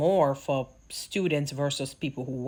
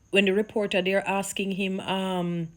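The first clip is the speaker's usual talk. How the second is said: normal talking voice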